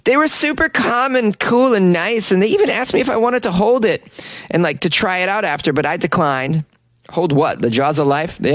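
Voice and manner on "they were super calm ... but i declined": put-on raised girlish quoting voice; young woman voice. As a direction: quote her lightly higher. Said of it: "Joe was able to step out".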